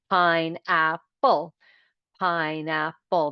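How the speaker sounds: slow pineapple split by syllables; pine-ap-ple, one clap each; clapping out each syllable of pineapple. Shown as a demonstration. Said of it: "I'm going to use clapping".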